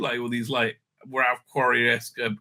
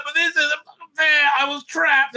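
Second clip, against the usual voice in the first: whiny plea; 12 semitones higher, 3 dB louder than usual. Acted. Captive pleads with captors.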